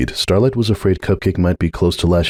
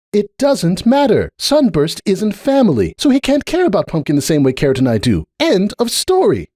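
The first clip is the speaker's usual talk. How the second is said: Cupcake; 13 semitones higher than usual. A narrator's reading. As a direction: lay it down word by word, closing the matter. Narrator voices Cupcake.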